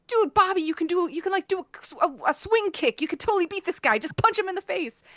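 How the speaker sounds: usual speaking voice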